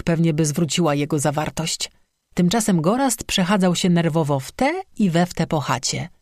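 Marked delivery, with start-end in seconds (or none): none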